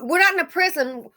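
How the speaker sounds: ordinary delivery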